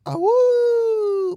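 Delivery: high loud wolf howl; werewolf howl. Pretend. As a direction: throw your head back and howl like a wolf. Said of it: "show us your wolf impression".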